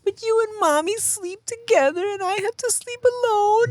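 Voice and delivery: put-on very high, drawn child whine; squeaky imitation of a child's complaint; little daughter protesting. Daughter protests parents sleep together, high plaintive child impression. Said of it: "She's like".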